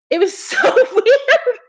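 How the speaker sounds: high-pitched, laughing through the words. Real, not acted. Streamer recalling her weird dream to viewers, laughing. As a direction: say it through a fit of giggles.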